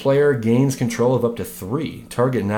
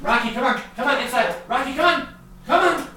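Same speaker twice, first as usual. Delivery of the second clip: calling the dog; 12 semitones higher, 4 dB quieter than usual. Real, not acted. Calling dog back inside.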